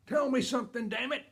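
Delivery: high, loud shout of frustration; exasperated dad's outburst. Retold or imitated. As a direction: yell it like a father at the end of his patience. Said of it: "then he says the magic word".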